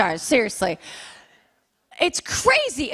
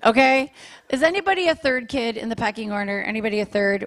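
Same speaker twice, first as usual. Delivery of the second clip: usual speaking voice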